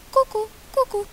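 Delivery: high birdlike kuku; cuckoo call. Acted. Cuckoo calling out after the quarrel. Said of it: "Zakukała".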